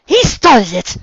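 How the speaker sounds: put-on high, loud childish protest; indignant shout. Girl blaming the villain to her partner, indignant shout. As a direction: yell it in self-defence.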